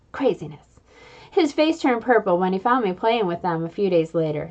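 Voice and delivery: usual speaking voice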